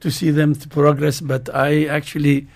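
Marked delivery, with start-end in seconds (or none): none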